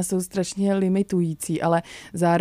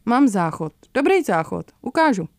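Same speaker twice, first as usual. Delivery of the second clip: lightly voiced shopkeeper; 4 semitones higher, 5 dB louder than usual. Shopkeeper offers toilet, dialogue.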